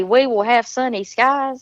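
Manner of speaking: a Southern woman repeats "We will have sunny skies" for the chat; Southern accent. Shown as a demonstration.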